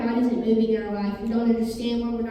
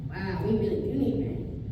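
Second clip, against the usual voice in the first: mild muttered sigh; 6 semitones lower, 7 dB quieter than usual. Retold or imitated. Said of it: "kicking around in that dusty soil like".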